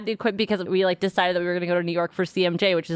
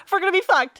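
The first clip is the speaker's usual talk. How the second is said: panicked cry; 12 semitones higher than usual. Retold or imitated. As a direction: panic spilling out at the end. Panic peaks in retold thoughts.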